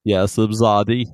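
light Troi voice, soft and low; a hint of Counselor Troi; Counselor Troi impression. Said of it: "that's a pretty good Troy".

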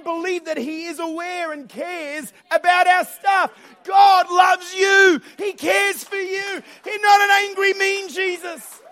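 high, slow, drawn-out proclamation; impassioned revival-style shout; shouted preaching. Real, not acted. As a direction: preach it at full force to the whole room. Preacher's climax of encouragement.

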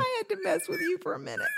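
laughing through the words; teasing, laughing. Real, not acted.

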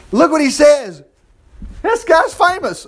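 high, slow marvelling; amazed exclaiming. Real, not acted. Teacher to class about Elijah, high and amazed. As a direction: savour it with wide-eyed wonder.

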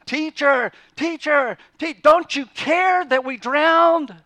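frantic high shout, waking someone in a storm; panicked shouting. Retold or imitated. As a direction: scream it in terror over the wind. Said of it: "I have no doubt they grab him, and they're shaking him".

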